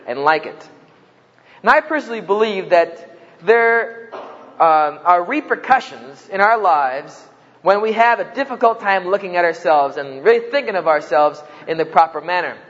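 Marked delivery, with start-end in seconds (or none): none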